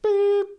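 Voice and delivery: high, loud horn beep; playful loud beep noise; mock beep. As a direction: honk like a scooter. Imitating scooter horn.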